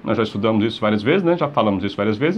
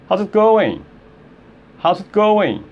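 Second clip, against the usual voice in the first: quick, contracted, higher pitch; fast demo; 6 semitones higher, slower than usual. Shown as a demonstration. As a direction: run the words together briskly. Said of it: "seria mais rápido". (rápido, emendando as palavras)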